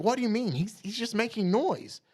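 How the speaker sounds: high, skeptical questioning; doubting voice. Retold or imitated. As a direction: brush off the claim. Voicing Barda doubting.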